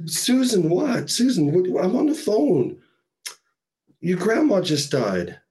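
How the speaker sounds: a mother interrupted during bad news; upset mother on the phone. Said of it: "She's like".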